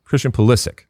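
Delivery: "Pulisic" with LIS stressed; stress on second syllable. Shown as a demonstration. Host modelling the name, "Pulisic" stressed on the second syllable. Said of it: "Second syllable".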